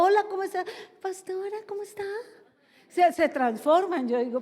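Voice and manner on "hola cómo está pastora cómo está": silly simpleton voice. Retold or imitated. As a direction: imitate a fake-innocent youngster greeting the pastor. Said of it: "esa voz como de bobito". (vocecita aguda de bobito)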